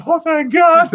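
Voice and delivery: high, squeaky mock relief; hammed-up high relieved squeak. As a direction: gasp it out like you just survived. Relief after the tree lands.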